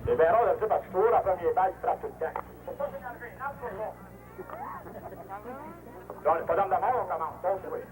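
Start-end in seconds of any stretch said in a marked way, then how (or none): 2.7-3.9 hushed calming. Acted. Repeats calming advice to batter.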